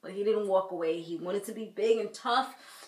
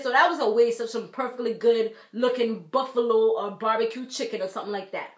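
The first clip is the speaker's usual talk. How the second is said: ordinary talk, nothing marked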